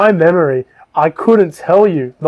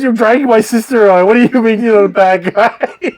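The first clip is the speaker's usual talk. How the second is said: higher, quick accusing retort; mock indignation; 6 semitones higher than usual. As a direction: play an outraged kid calling them out. Joe challenges the gnomes, acted.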